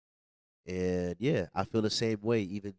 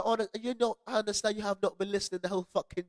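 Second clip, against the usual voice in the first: high-pitched mock exasperated rant; retold exasperation; 11 semitones higher than usual. Retelling to friends who never listened, mock exasperation.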